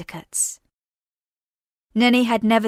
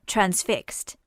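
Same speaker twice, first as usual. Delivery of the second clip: ordinary delivery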